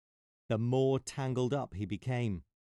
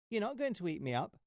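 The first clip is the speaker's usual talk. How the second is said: high, fast squeaky insistence; high quick mouse voice; confident mouse; 10 semitones higher, faster than usual. Acted. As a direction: rattle it off like a bold mouse. Mouse voice in story.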